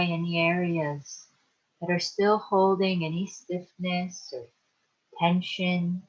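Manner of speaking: calm, unhurried meditation guide with long pauses; guided meditation. Acted. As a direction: ease them into relaxation, leaving space to breathe. Leads a guided relaxation meditation.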